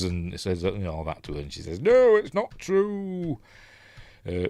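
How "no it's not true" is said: high, drawn-out cry of denial; hammed-up imitation of a wronged woman; mock protesting wail. Voicing the accused queen's protest.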